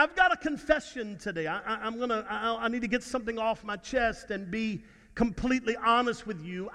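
ordinary talk

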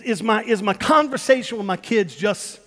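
usual speaking voice

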